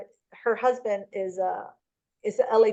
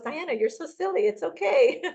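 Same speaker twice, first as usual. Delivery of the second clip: higher, amused mock teasing; teasing laugh; 7 semitones higher than usual. Friends teasing the cautious agent, amused and higher. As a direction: tease her fondly, half laughing. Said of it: "they're laughing at me".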